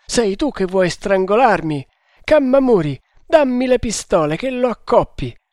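high, loud raving accusation; delirious shouting. Acted. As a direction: cry out wildly, demanding a weapon. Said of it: "gridò".

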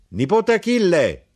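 high, loud call across the shop. Acted. Uncle calls his nephew Achille from the harness, loud call. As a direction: summon the boy from the back.

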